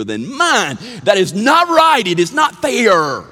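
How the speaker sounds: hammed-up child's indignation, rising to a shout; high, loud mock tantrum over unfair shares; outraged kid protest. Retelling siblings' quarrel over candy.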